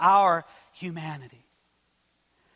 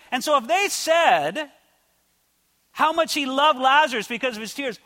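loud, raised, driving sermon delivery; full-force preaching; 6 semitones higher, 5 dB louder than usual. Real, not acted. Preacher telling the congregation Jesus died for them, forceful. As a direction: proclaim it with rising force to the congregation.

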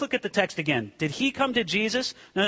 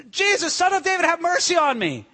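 high, urgent plea; acted desperate cry; 9 semitones higher than usual. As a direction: call out high, unable to hold it in. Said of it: "a scream, almost an animal cry".

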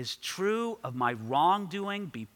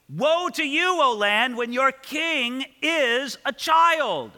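higher, louder, slow proclaimed verse; scripture reading; 5 semitones higher, 4 dB louder, slower than usual. Acted. Preacher rereads the warning verse to the congregation, slow and raised. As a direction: declaim the warning slowly.

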